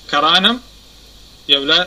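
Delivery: usual speaking voice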